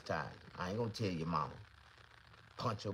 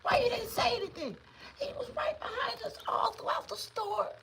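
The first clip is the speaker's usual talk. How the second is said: high, shrill scolding mother's voice; a mother scolding her son in alarm; hammed-up shrill mom voice, much higher; 5 dB louder than usual. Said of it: "I just heard her say".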